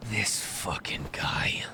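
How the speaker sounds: whispered slow curse under breath; hushed curse. Acted. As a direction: spit it out in a whisper, slowly. Bully mutters at intruding adult.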